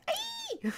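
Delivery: very high sharp yelp; high yelp of pain. Acted. Yelping as the knife hits the eye.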